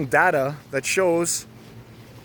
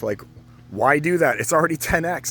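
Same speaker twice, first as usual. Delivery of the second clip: normal talking voice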